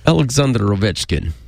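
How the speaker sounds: put-on steadier Russian accent; a hint of Russian pronouncing names. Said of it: "Okay, that was good".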